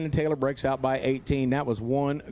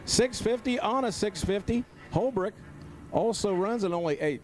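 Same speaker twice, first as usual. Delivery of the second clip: higher-pitched excited race announcing; live race call; 5 semitones higher than usual. Real, not acted. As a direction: drag race play-by-play at the finish.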